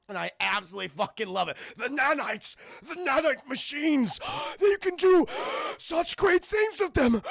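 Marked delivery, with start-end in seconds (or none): 1.8-7.2 high, loud overacting villain with big gasps; the Doctor. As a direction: declaim it like a wheezing mad scientist. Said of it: "he talks that way throughout the entire film".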